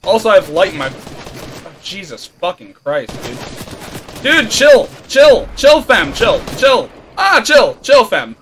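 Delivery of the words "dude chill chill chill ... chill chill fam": loud pleading shouts; shouting at him to stop; yelling. Real, not acted. Yelling at enemy player.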